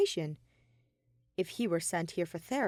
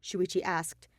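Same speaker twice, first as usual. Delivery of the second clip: ordinary delivery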